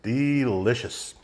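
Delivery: slow, drawn-out pitchman voice with a rising end; salesman's delicious. Retold or imitated. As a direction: stretch it out like a salesman. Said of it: "Like one of my salesmen says".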